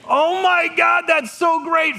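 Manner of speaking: high, loud delighted cry; excited shout. Retold or imitated. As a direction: squeal it out with joy. Nursing cheers in the OR.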